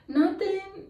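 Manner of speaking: high, innocent "nothing"; fake innocent. Answering the sergeant's question, feigning innocence. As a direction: play dumb, sing it out high. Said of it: "Acting like I didn't do anything".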